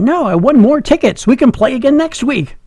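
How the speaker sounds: high-pitched imitation of an excited father; excited dad. Dad telling his son about winning tickets, excited. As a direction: act out dad thrilled with his win. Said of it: "and he's excited".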